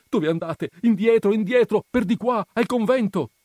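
high, loud frightened urging; trembling alarm. A narrator's reading. Menico running up to warn the couple, panicked urging. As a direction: urge them back in a panic. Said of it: "ancor tutto tremante, con voce mezza fioca".

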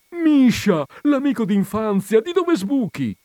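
very high, loud exclamation; amazed greeting. Acted. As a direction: cry out on meeting a childhood friend. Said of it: "si meravigliò il magro". (meraviglia gridata, molto acuta)

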